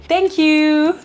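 high, drawn-out singsong thank-you; delighted thanks. Real, not acted. Vlogger thanking a viewer for kind words, delighted singsong.

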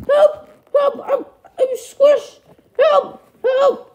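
very high falsetto panic, short bursts; cartoonish high-pitched distress calls; squashed cry for help. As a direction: squeal for help from under a heavy weight. Parent squashed by heavy son.

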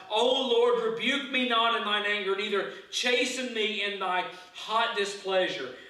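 loud, high, pleading cry; anguished loud plea to God. Acted. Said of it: "he is crying out to God".

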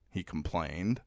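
ordinary delivery

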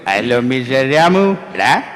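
put-on suave old-film villain, slow opening; Jose Prakash impression. Main villain ordering a gem sword shipped, mimicked menace. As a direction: draw out the greeting like a screen villain. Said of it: "അദ്ദേഹം വില്ലനായിട്ട് അഭിനയിക്കുമ്പോൾ".

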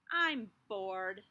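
plain reading voice

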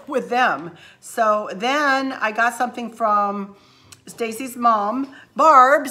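ordinary delivery

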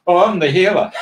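laughing through the line, loud; half-laughing quote of the snake; amused, loud retelling of a dream line. Retold or imitated.

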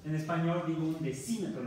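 ordinary talk, nothing marked

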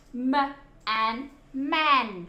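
"m", then "an", then whole "man"; sounding out phonics. Shown as a demonstration. Teacher showing children how man reads, "m" and "an" blended. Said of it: "nên nó đọc là".

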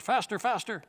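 excited yapping call; barking out. Acted. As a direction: dogs egging everyone on at a run. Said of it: "But the dogs barked".

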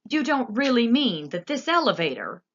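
horrified protest; alarmed shout. A narrator's reading. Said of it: "shouted Grandpa Joe".

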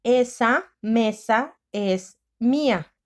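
very slow, word by word; slow dictation voice. Shown as a demonstration. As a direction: dictate slowly for the class. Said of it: "Primer tiempo".

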